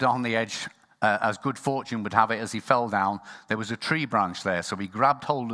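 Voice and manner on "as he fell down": ordinary talk, nothing marked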